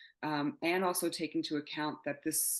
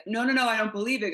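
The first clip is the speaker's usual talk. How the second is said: loud, fast, higher-pitched protest; rapid-fire retold denial, voice raised; retold disbelief; 6 semitones higher, 9 dB louder, faster than usual. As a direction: re-enact frantic refusal to believe. Acting out disbelief on the call.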